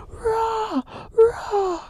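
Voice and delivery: high squeaky mock roar. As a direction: let out a shrill little roar. Growling noise after wake-up.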